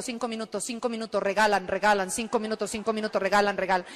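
low, soft, droning repetition; nagging inner loop. Retold or imitated. The phrase loops in her head, low drone. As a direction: repeat it like a thought drilling the brain. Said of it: "me quedó taladrando en el cerebro".

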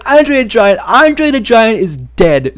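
retelling a fan heckling Heyman, mock jeer; mocking heckler. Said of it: "Someone was like".

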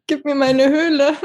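laughing plea. Pretend. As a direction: laugh through it, playful. Jokingly demands her cave.

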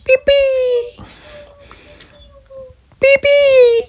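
high, held beep noises; imitated beeping. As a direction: squeal out long high beeps. Imitates noises in streamer's banter.

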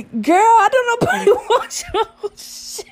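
laughing retort. Real, not acted. Laughing at her claim.

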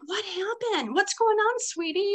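put-on high, gentle coaxing voice to a crying child; sweet concerned teacher. Teacher asking a crying boy what happened, high and gentle. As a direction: soothe the boy like a worried teacher. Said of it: "And I'm like".

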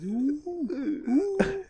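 high squeaky ooh like a machine; washing machine squeal. Retold or imitated. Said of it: "makes a real high pitch".

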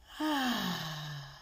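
long audible exhale sigh; loud sigh. Shown as a demonstration. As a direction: let stale air out in a sigh. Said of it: "we exhale with a loud sigh".

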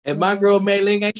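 normal talking voice

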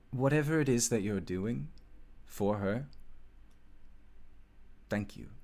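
awed elf smith talking to herself; elf smith Marissa, in awe. Acted.

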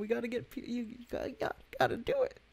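squeaky high plea, pushing a friend; high pleading voice. Pretend. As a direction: pitch it way up and plead. Urging friend to speak French.

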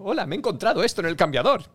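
high, loud, overplayed stage line; badly acted line. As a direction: ham up a clumsy improvised line. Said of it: "espero no haberlo dicho tan mal como lo acabo de decir".

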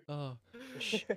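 shushing through laughter; helpless laugh breaking into a shush. Real, not acted. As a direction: try to hush them while cracking up. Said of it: "You're making me laugh".

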